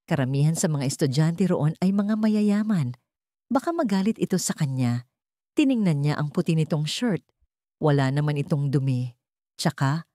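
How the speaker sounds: ordinary delivery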